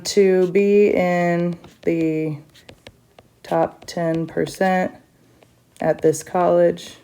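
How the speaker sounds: slow, spaced dictating pace; slow dictation. Shown as a demonstration. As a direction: spell out the conclusion slowly as you write. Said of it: "So how do I conclude this?".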